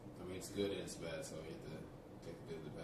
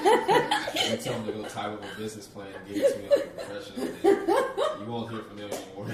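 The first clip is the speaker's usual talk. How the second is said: loud laughing through the words; laughing loudly; 4 semitones higher, 20 dB louder than usual. Real, not acted. To the host about beggars, laughing loudly. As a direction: laugh it out, much louder.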